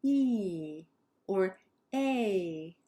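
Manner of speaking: "E" and "A" each drawn out; long vowels. Shown as a demonstration. Teacher modelling what sounds long, drawn-out "E" and "A". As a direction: stretch "E" and "A" with a falling glide. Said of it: "that sounds long".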